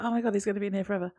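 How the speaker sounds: quick, mock-worried blurt; rushed worry. Viewer recalling worry over Sam stuck in hospital, rushed. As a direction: rattle it off as a retold fret. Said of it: "I was like".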